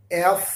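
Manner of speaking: एफ said with rounded lips and air pushed out; एफ with breath blown out through the lips; pron demo F. Shown as a demonstration. Teacher showing students how एफ should sound. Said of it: "मैं एफ को कैसे बोल रहा हूँ".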